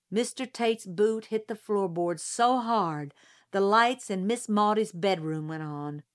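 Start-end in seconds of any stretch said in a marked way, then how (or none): none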